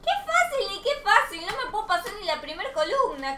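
exasperated high whine; indignant protest. Real, not acted. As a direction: scoff at being told it's easy, voice up high. Protests that level is easy.

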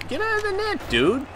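high squeaky yell of disbelief; sharp high outcry; exasperated yell. Real, not acted. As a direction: yell at the teammate in your net. Yelling at a teammate.

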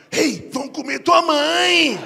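slow, loud drawn-out ghost call; ghostly shout. Acted. Lost soul loudly calling to the child, slow. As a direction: drag it out like a spooky spirit. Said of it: "pra fazer assim, ó".

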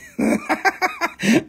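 high-pitched cackling laugh. Real, not acted. Seller laughing at own jumbled accents, high cackle. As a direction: burst into a high giggle.

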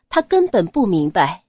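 ordinary delivery

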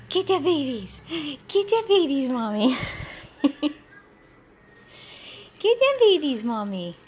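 high, playful urging, falling on each phrase; excited pet talk. Real, not acted. Owner urging her dog to get the toys, excited baby talk.

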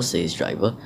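ordinary delivery, nothing marked

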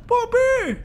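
loud, high cry of the name; desperate call. Real, not acted. Streamer cries after Poppy as she is snatched away, desperate shout.

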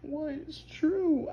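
ordinary talk, nothing marked

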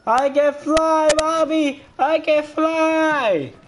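high, slow, stretched-out yell; hammed-up cry of flying; mock joyful cry. Falling, playful mock cry.

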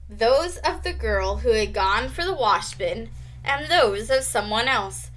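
plain reading voice

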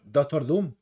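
DOCTOR DOOM said short and unforced; Spanish pronunciation. Shown as a demonstration. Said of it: "Yo lo pronuncio en español".

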